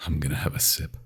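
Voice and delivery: hushed, breathy whisper before drinking; a secretive vampire whisper. Acted. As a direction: whisper it as if savoring the moment. Vampire about to drink blood.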